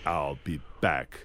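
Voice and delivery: low, slow, robotic I'll be back; Terminator line reading. Shown as a demonstration. Actor giving kids the line as in the movie, robotic. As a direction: drop into the movie machine voice. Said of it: "the way you say it in the movie".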